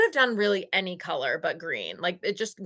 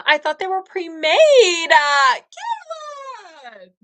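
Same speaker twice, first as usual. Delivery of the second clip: touched disbelief; 8 semitones higher, 5 dB louder than usual. Real, not acted. Touched by Kayla's kindness.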